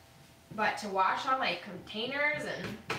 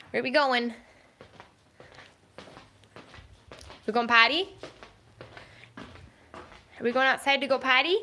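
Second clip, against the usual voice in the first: talking to a dog; 3 semitones higher, 7 dB louder than usual. Real, not acted. Following dog, asking about potty.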